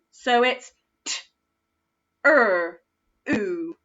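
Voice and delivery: t, er, oo said as separate sounds; sounding out. Shown as a demonstration. Teacher sounding out true for a child. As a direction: segment t, er, oo one at a time. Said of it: "oo sound this time".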